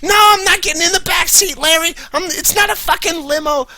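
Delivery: hammed-up hysterical Susie. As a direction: scream at Larry like a woman in hysterics. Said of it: "most frustrating dialogue".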